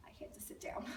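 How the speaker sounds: whispered, as if knocked back; hushed, breath-only aside; stunned hush. Acted. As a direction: drop to a near-whisper in mock shock. Mother stunned by the news.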